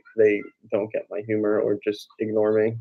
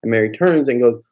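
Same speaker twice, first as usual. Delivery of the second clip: normal talking voice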